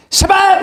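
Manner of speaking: very high, explosive sound effect; imitated impact noise. Friend knocks him out at the bar, imitated impact noise. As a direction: make the hit with your voice. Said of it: "like that".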